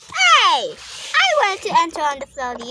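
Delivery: loud indignant call; shouting. Pretend. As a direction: yell it across the pool. Doll play, calling out.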